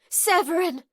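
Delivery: high, loud panting cry; panted name. A narrator's reading. Rayna panting her lover's name, high and loud. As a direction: gasp his name out, breathless. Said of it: "She panted his name over and over again".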